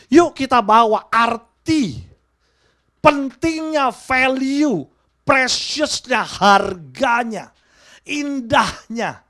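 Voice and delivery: drawn-out, loud stress on each word; slow, weighty call to the congregation; slow emphatic preaching. Acted. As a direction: hammer each word slowly home. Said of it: "preacher stretching each word".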